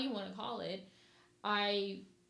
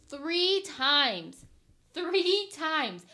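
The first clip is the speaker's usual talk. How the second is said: exasperated, louder each word lands; frustrated fiancée venting about moving the date, loud; emphatic loud repeat; 5 semitones higher, 10 dB louder, much slower than usual. Real, not acted. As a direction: hammer the count out loud and falling.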